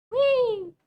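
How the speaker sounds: high, loud gleeful squeal; playful whee. Pretend. Streamer moving their avatar back into the tree, gleeful squeal. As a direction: squeal like a kid on a slide.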